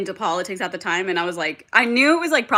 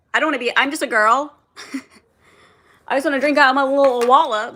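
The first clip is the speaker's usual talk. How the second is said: put-on higher, louder, fast girlish act with a laugh; mock ditzy girl; 5 semitones higher, 5 dB louder, faster than usual. Joking to viewers, mock ditzy girl voice with laughter. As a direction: play the carefree little girl, hammed-up.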